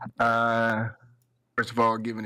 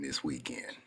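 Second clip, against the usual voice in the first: whisper; 7 semitones lower, 7 dB quieter than usual. Real, not acted. Trails off unvoiced.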